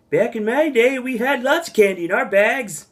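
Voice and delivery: high, creaky grandpa voice; crotchety old timer complaining; old man voice. Pretend. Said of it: "It's my old man voice".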